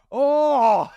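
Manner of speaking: one high, loud moan. Retold or imitated. Answering the missus with a high loud moan. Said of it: "just moaning".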